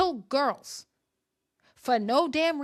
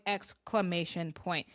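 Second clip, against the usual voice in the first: exclamation point, slow and much softer; slow soft aside; 5 semitones lower, 7 dB quieter, slower than usual. Shown as a demonstration. Reading the tweet to viewers, slow soft aside. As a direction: let it trail off low and slow. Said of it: "She's yelling at young people".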